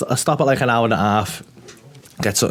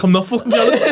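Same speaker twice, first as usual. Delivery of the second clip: laughing denial; 9 semitones higher, faster than usual. Retold or imitated. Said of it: "I was like".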